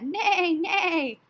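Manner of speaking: high, loud neighing horse voice. Acted. Teacher voicing the horse for her class, high and loud. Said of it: "The character said".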